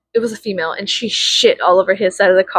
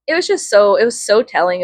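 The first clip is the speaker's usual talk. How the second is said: ordinary talk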